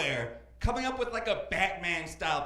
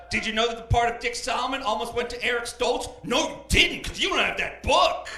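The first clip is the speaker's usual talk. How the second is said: loud, worked-up scolding rant; hammed-up outrage, building to a high finish; mock angry tirade; 4 semitones higher, 4 dB louder than usual. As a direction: berate a child as an offended Santa. Acted Santa tirade at the child.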